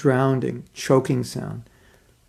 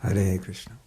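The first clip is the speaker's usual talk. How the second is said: ordinary talk, nothing marked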